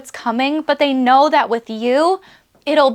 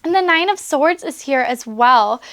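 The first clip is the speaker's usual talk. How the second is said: ordinary talk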